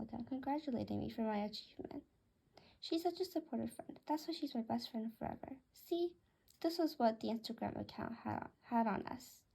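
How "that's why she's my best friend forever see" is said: usual speaking voice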